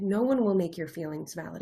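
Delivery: ordinary talk, nothing marked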